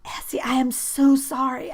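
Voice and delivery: high loud panicked "Essie, I am so sorry"; frantic apology. A narrator's reading. Boy panicking after being pushed away, frantic apology. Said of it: "he repeated".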